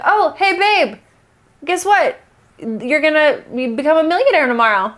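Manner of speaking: high-pitched, chatty mock news; playful horoscope reading. Reading a horoscope to a partner, playful and light. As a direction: share silly news with a partner. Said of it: "like reading a horoscope now".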